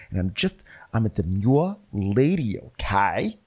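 halting, defensive impersonation with questioning rises; exaggerated prim, flustered lady; Ramona. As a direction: stammer out mock composure in jerky bursts. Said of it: "very good impressions of people".

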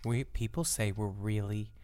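very soft and low; hushed podcast voice. Shown as a demonstration. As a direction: keep it tiny before the outburst. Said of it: "you know what that makes me wanna be".

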